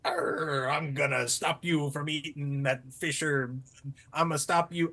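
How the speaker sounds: very low, slow growling dog; heroic dog. Acted. Storyteller playing the dog stopping the fisherman, deep growl. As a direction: growl a deep warning to the villain. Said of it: "the dog was like".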